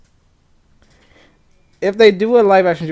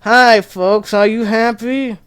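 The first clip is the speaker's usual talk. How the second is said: slow, droning hound voice, drawn out; a lightly voiced Droopy, slow and deadpan; Droopy's greeting; 6 semitones higher, 4 dB louder, much slower than usual. Droopy in a mask greeting the audience, slow deadpan. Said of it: "and he go".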